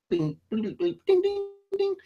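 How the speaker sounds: very high squeaky ding, ding imitation; phone dings. Phone alerts mimicked in a very high squeak.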